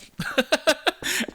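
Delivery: giggle. Real, not acted. Laughing at own deep voice.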